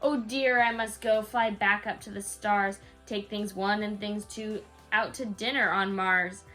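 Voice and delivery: ordinary delivery, nothing marked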